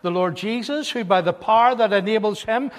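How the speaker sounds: loud, driving pulpit recitation; fervent scripture reading. Real, not acted. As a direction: read the verse out with mounting force.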